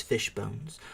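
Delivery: ordinary delivery, nothing marked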